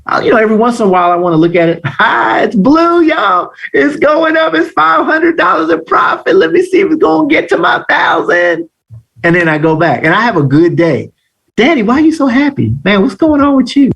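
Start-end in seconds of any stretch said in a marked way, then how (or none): 2.0-8.7 high-pitched excited yelling, acted out. Trader checking a winning trade, excited. 11.6-13.9 lightly voiced child, higher pitch; a hint of a puzzled kid, higher and quick; child asking. Child asking daddy why he's so happy, lightly voiced.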